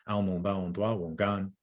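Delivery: "Al non ba on doa wongan" with a falling tune on doa; Abenaki pronunciation. Shown as a demonstration. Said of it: "how you'd say the Abenaki language in Abenaki".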